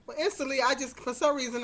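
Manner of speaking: usual speaking voice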